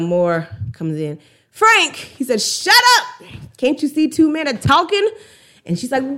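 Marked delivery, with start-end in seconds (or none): 1.5-2.0 high, loud call of his name; imitating a woman calling out; interjecting woman. Acting out woman interrupting men. 2.4-5.1 sudden high, loud mock outburst; imitated furious rebuke, easing slightly after the first words; angry retort. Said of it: "that rage and anger and frustration".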